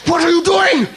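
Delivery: high, shrieking mock outrage; imitating outraged doctors. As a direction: scold him like an idiot, hammed-up. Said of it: "all these doctors and scientists ... going".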